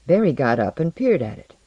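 ordinary delivery

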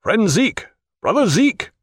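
calling out. A narrator's reading. Jack summons Zeke to the door, calling out. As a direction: call a friend from another room. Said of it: "he cried".

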